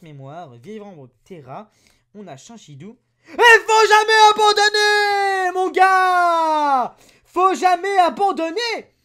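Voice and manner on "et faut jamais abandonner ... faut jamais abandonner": slow, very loud, high triumphant yell; ecstatic shout. Real, not acted. After a great pull, ear-splitting triumphant yell. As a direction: bellow it out in triumph. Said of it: "désolé pour vos oreilles".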